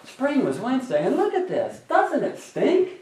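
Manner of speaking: mock gloomy complaint. Preacher playing the grumbler to the congregation, exaggerated high whine. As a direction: groan about the snow like a put-upon kid. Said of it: "if I come and say".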